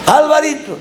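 high-pitched imitated call; grandmother calling. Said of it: "mi abuela". (imitando el llamado agudo de la abuela)

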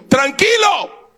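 high, drawn-out shouted command; acted booming order to a desperate man; commanding Spirit voice. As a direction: call it out slowly from on high. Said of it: "oí la voz del Espíritu que me dijo".

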